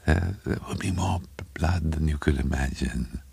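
soft, halting, wet speech; bubbling impediment. A narrator's reading. Little man to his neighbour, bubbling and breathless. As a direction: stumble softly over the words. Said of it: "There was a little bubble in his speech like an impediment".

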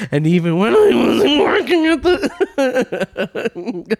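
slow, laughing parody of her; laughing mock imitation. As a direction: drag it out while cracking up.

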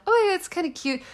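high cooing over a cute bug; cooing at something cute. Retold or imitated. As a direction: coo at it, charmed. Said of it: "I'm like".

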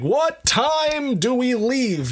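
slow, high, overly clear question; charades mime. Retold or imitated. Asking the German driver in slow exaggerated speech. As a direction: spell it out slowly for someone without English. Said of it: "I'm doing charades".

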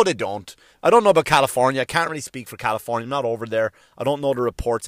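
ordinary delivery

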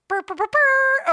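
high loud buzzing noises; imitated fart notes. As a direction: blow out brassy fart tones.

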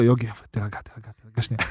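a soft hush for a panicking teammate; calming. Retold or imitated. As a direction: soothe him quietly, tell him to calm down.

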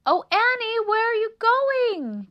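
loud singsong mouse holler; calling out. A narrator's reading. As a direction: call after a fleeing friend. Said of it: "called Melissa".

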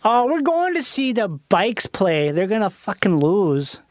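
slow mock Wisconsin drawl; caricatured Upper Midwest sports fan; Wisconsin accent. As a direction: stretch the Wisconsin vowels out. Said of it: "talked like that".

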